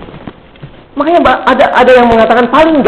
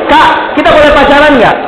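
higher-pitched imitation of a schoolboy asking; acted schoolboy question, pitched up; kid's question; 7 semitones higher than usual. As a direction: mimic a kid piping up with a question. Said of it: "Dia nanya gitu".

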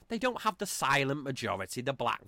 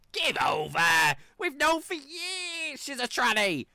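Joan Rivers; 8 semitones higher than usual. Retold or imitated. Imitating a famous comedian's shouted put-down. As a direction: bark it out like a gossiping comic who's known for years. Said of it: "The way she delivered that was fucking great".

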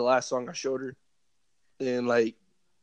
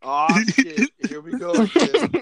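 mock despair; 5 semitones higher, 5 dB louder than usual. Real, not acted. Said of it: "That's how I feel right now".

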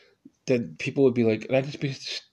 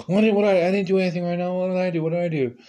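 startled panic; 7 semitones higher, much faster than usual. Retold or imitated. Panicked waking from sleep.

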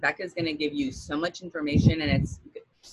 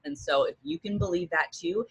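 ordinary talk, nothing marked

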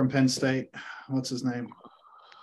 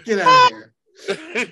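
slow, laughing, higher get out of here; laughing disbelief; 7 semitones higher, 7 dB louder, much slower than usual. Real, not acted. Laughing disbelief at fake accent.

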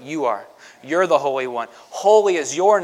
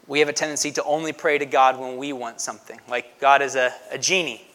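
ordinary delivery

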